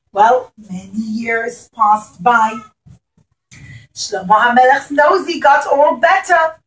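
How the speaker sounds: ordinary delivery, nothing marked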